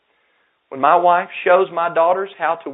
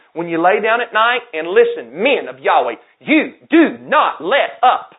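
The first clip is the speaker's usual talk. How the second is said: loud, high, fervent sermon exhortation; thundering pulpit preaching; preaching at full force; 6 semitones higher, 6 dB louder than usual. Acted. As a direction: drive each command home to the fathers. Preacher exhorting fathers loudly.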